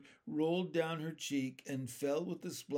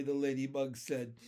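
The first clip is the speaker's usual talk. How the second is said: plain reading voice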